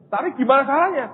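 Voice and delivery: high, loud pleading to God; pleading question. Retold or imitated. Pastor pleading with God, retold.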